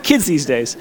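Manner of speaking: usual speaking voice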